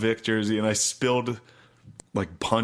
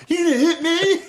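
high, loud taunt through laughter; laughing taunt; 6 dB louder than usual. Retold or imitated. Son taunting his father, laughing loudly. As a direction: taunt dad while cracking up.

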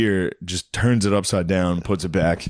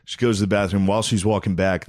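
usual speaking voice

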